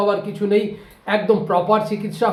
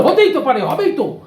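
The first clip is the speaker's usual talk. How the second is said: mock insistence; 6 semitones higher, 4 dB louder than usual. Neighbour insists it will happen.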